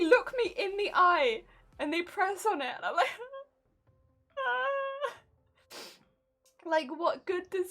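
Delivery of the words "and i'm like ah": mock pained cry. Doctor presses sore stomach again.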